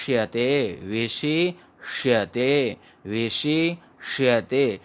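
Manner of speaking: विशिष्यते read slowly with a small break before श्यते; teacher modelling विशिष्यते slowly; slow careful reading. Shown as a demonstration. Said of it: "विषि के बाद में comma लगा के".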